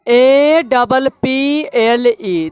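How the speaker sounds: letters spelled out very slowly; slow spelling. Shown as a demonstration. Teacher spelling a word to pupils, very slowly. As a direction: spell each letter for the class. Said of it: "चलो अब देखो यहाँ से".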